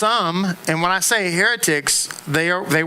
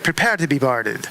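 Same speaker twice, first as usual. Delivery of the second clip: a light Jamaican brogue, like a pirate; a hint of Jamaican brogue; 4 semitones lower than usual. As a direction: lightly imitate a Jamaican girl sounding like a pirate. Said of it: "she sounds like a pirate".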